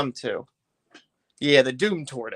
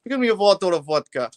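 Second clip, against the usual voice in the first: mock Russian accent on vodka, a touch louder; 4 semitones higher, 5 dB louder than usual. Shown as a demonstration. Streamer demos his Russian accent for viewers, mock Russian. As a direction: play a Russian asking for vodka, light Russian accent. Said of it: "I can pull off a Russian accent pretty well".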